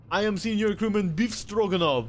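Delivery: put-on Stroganoff with Beef said as "Beef", loud Russian accent; Russian sailor accent. Sailor corrected name, loud Russian accent. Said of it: "his name is not Beef Stroganoff".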